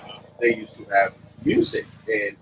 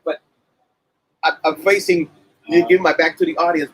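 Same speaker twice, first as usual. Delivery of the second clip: ordinary delivery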